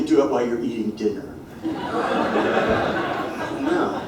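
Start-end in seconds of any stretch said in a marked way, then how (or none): 1.6-3.6 breathy silent laugh rising; unvoiced laugh. Real, not acted. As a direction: laugh at your own punchline. Laughs at own joke.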